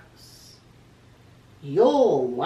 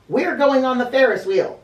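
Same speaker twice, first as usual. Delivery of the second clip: bossy; 6 semitones higher, 10 dB louder than usual. Acted. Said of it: "she said".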